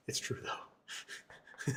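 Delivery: whispered under the breath; whispered aside. Real, not acted. Streamer whispering a joke aside to chat. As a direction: slip it in as a hushed aside.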